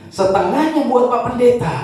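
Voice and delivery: put-on high, sweet old grandma voice; grandma's voice. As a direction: play the cheerful old oma. (suara nenek tinggi dan riang)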